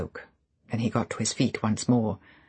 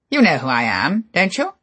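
louder, rising challenge; Favell pressing; 6 semitones higher, 8 dB louder than usual. Acted. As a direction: push him to admit it.

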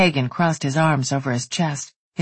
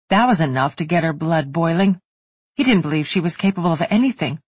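usual speaking voice

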